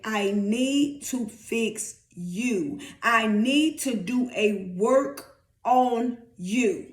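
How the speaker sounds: very slow, spaced-out words; slow, deliberate preacher's quote; God speaking. Acted. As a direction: deliver each word as a solemn pronouncement.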